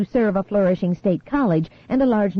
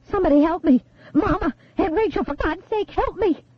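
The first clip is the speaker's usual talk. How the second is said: high, desperate cries for help; panicked screaming; 10 semitones higher than usual. A narrator's reading. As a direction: scream it as if trapped. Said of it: "flailing and screaming".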